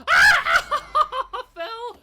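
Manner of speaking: high laughing yell; laughing shout. Real, not acted. Falls in game, laughing shout.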